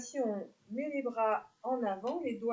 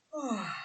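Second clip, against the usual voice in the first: open-mouthed "ah" sigh; demonstrated yawning exhale; 2 semitones higher than usual. Shown as a demonstration. Teacher showing the jaw-stretch exhale, demonstrated yawning sigh. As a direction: let the breath fall out in a yawn. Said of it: "On va laisser l'expiration, le bâillement sortir".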